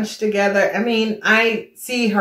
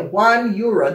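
ordinary delivery

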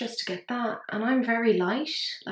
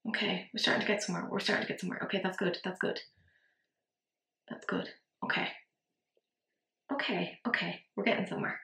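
softer, half-whispered, talking herself through it; quiet self-encouragement; 2 semitones lower, 7 dB quieter, faster than usual. Real, not acted. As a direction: murmur relief to yourself as it finally works. Quietly encouraging herself while applying.

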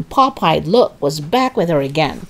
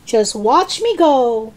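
slow, high, boastful call; 5 semitones higher, slower than usual. A narrator's reading. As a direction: stretch it out as a proud call from above. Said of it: "she called down to George".

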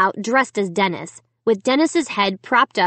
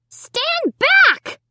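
very high, shrill shouted command; high, shrill order; 4 dB louder than usual. A narrator's reading. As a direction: order them back in a shrill little voice. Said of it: "ordered in a high, shrill voice".

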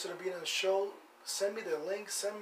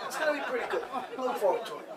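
higher, laughing through the words; 6 semitones higher, faster than usual. Real, not acted. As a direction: chuckle at your own boast. Jokes about own karaoke act.

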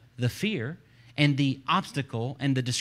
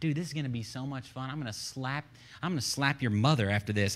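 quiet, cocky trash talk; soft, casual taunts as if under the breath; mocking undertone; 5 dB quieter than usual. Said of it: "he was mocking Goliath the whole time".

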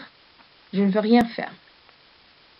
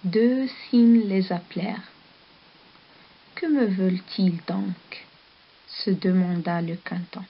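put-on calm storybook reading for children; story narration. A narrator's reading. Teacher reading a story to the class, storybook voice. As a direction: read the tale gently to the pupils.

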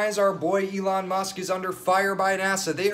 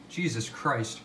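ordinary talk

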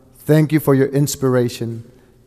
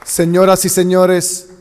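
raised, formal ceremonial announcing; ceremony announcer; 5 semitones higher, 4 dB louder than usual. Acted. Host announcing the candidates to the crowd, formal emcee call.

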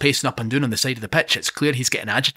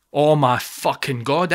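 plain reading voice

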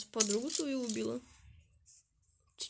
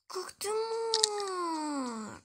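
mock wail; 7 semitones higher, much slower than usual. Teammate took her item.

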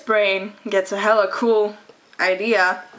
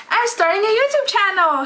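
excited; 11 semitones higher, 3 dB louder than usual. Acted. Excited announcement opening the vlog.

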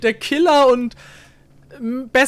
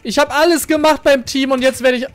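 usual speaking voice

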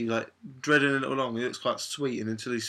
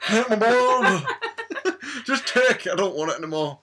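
much higher, louder plea breaking into laughter; laughing mock plea; 10 semitones higher, 6 dB louder than usual. Mimicking her friend on the bed, begging through laughter. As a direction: beg dramatically while cracking up.

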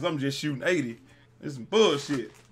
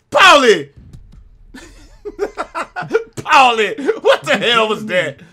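high, loud laughing yells; laughing shout; 10 semitones higher, 12 dB louder, slower than usual. Real, not acted. Gamer laughing and yelling at teammate Paulie.

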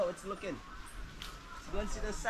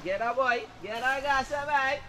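calling from far away; 9 semitones higher, slower than usual. Real, not acted. Calling to a partner in tree.

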